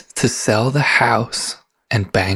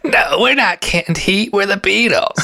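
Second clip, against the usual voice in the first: mock Beatle; 7 semitones higher than usual. Playing [name].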